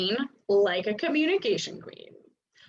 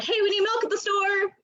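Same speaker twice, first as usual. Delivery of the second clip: high sing-out to someone leaving; offhand high-pitched holler; calling out; 10 semitones higher than usual. Acted. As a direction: toss it after them in a high voice. Hurried call to departing partner.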